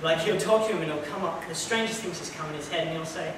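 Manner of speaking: ordinary delivery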